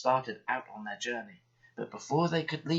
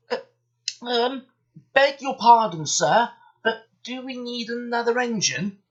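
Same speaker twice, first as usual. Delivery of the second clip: usual speaking voice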